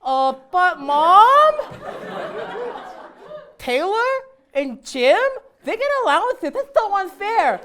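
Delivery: hammed-up whiny kid voice. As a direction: whine like a spoiled little boy. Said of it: "I can imagine Andrew now as a kid".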